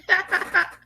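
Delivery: a short shrieking laugh; high squeal of laughter. Real, not acted.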